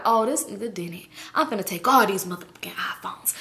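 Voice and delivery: soft, breathy whisper to herself; quiet plotting whisper; whispered scheming. Retold or imitated. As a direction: whisper the plan under your breath. Scheming aloud, thinking to self.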